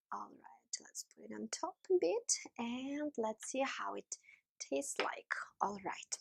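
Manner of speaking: quick soft whisper. Real, not acted. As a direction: whisper it while you work. Whispered while adding mustard.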